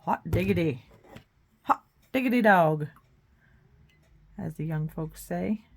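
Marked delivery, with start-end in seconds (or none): 0.0-2.9 folksy exclamation. Pretend. Old folksy exclamation, played up.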